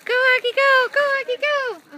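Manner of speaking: high, loud cheering; yelling from the sidelines. Real, not acted. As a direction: shout the dog on. Cheering dog on.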